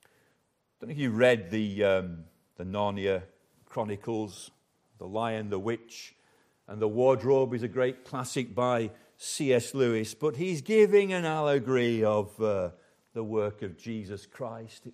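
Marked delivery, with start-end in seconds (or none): none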